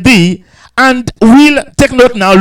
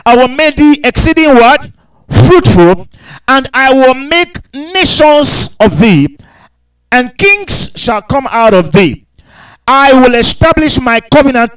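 higher, slow scripture proclamation; lifted pulpit voice with a question to the crowd; raised preaching read; 3 semitones higher, slower than usual. Acted. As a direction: call the promise out to the church. Reads verse six with call-outs.

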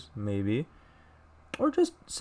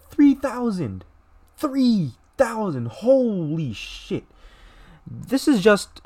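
much higher, strained and pained; stunned disbelief; 9 semitones higher, slower than usual. Real, not acted. Reacting to three thousand dead kids, stunned and pained. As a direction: let the number hit you, voice cracking upward.

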